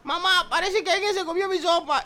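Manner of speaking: put-on baby bear voice. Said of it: "Y le dice a la mamá oso".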